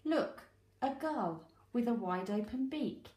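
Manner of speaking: usual speaking voice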